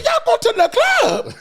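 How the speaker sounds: high, loud disbelieving question; shocked high-pitched driver; incredulous driver. Retold or imitated. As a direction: squawk it in disbelief.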